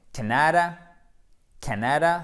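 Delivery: "Canada" with short A, stressed on "Can"; first-syllable stress. Shown as a demonstration. Teacher demonstrating for students, "Canada" stressed on first syllable. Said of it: "c'est un A court, accent tonique sur la première syllabe".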